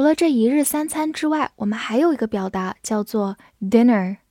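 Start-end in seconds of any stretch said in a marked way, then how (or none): none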